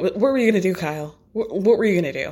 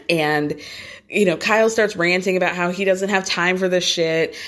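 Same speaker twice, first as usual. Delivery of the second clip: normal talking voice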